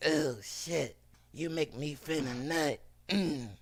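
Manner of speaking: soft monster voice in mock passion; monster-like woman. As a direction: mimic her wrecked voice in bed. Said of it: "Could you imagine her in the bedroom?".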